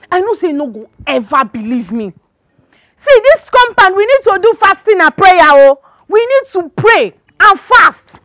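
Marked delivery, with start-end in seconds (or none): 3.0-8.2 loud, high-pitched call to prayer; fervent exhortation. Acted. Woman urging the compound to pray and fast, fervent and loud. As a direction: urge them hard, like a fired-up prayer leader. Urges prayer against evil.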